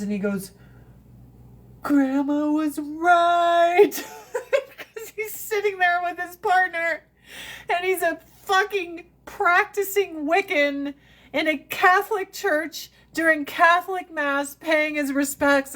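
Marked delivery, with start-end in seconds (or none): 1.8-3.9 slow, high, louder deadpan line. Retold or imitated. Friend's deadpan remark in mass. 3.9-15.7 talking high through laughter; words spilling out while still giggling; laughing. Real, not acted. Laughing at the memory.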